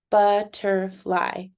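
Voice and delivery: butterfly slowly split into three beats; syllable by syllable. Shown as a demonstration. Teacher repeating the count for learners, butterfly syllable by syllable. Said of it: "count the syllables".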